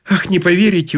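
a touch of despondent sighing; glum sigh. A narrator's reading. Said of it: "уныло вздохнула она".

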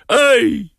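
very high-pitched yelp; startled cry. A narrator's reading. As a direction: yelp "ай" as a talking cat. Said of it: "вскричал бегемот". (тонкий вскрик)

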